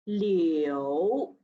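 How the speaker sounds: "liu" dipping low, third tone. Shown as a demonstration. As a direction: let "liu" dip down. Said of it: "Line number fourteen".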